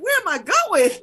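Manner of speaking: loud, high indignant comeback; annoyed retort. Retold or imitated. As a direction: fire the question back, high and loud, re-enacting annoyance. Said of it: "'cause I'm getting annoyed".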